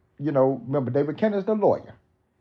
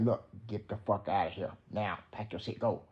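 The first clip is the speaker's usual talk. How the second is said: very soft, slightly lowered voice; imitated hushed dismissal; 5 semitones lower, 11 dB quieter than usual. Imagining Reggie dismissing Frank, imitated hushed. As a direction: quote the order under your voice.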